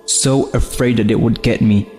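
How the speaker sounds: plain reading voice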